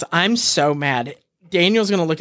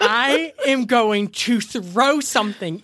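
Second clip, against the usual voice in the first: drawn out, higher, slow exasperated wail; 6 semitones higher, slower than usual. Real, not acted. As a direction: stretch it out in real disbelief. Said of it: "I'm dead".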